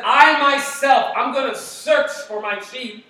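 loud, high, slow divine declaration; voice of the Lord. Acted. Pastor voicing God to the people, loud and slow. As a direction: proclaim it as God searching himself. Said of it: "If the Lord says".

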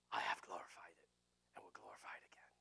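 hushed, breath-only whisper; whispered divine voice. Shown as a demonstration. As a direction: whisper the words of God clearly. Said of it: "is that a big difference between".

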